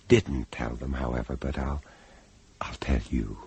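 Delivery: low, hushed near-whisper; soft, low, secretive aside; confiding. Acted. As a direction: let the listener in on a secret. Narrator confides a final secret.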